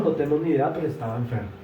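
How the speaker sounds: ordinary talk, nothing marked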